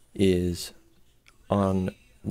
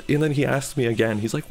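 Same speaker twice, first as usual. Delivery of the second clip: ordinary delivery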